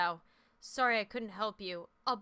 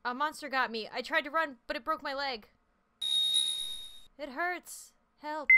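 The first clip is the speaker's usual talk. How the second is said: put-on pained young girl, a bit higher; hurt girl voice; 5 semitones higher, 4 dB louder than usual. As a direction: whimper it like an injured girl. Voicing hurt girl Misao.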